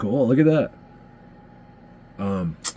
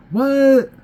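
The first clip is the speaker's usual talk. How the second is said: sharp high startled what; a genuine jolt of surprise, jumping way up; surprised what; 4 dB louder than usual. Real, not acted. Sudden reaction to the footage.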